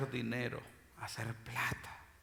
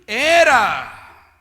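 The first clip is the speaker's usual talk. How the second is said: loud, high preacher's cry; preaching at full force; 10 semitones higher, 13 dB louder than usual. Acted. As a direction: preach it out to the church. (predicando a todo pulmón)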